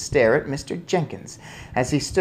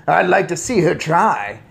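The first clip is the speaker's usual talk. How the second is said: loud, higher challenge; defiant shout; 6 semitones higher, 6 dB louder than usual. A narrator's reading. Father defies the witch, shouting. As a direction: puff it out boastfully. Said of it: "shouted Mister Jenkins".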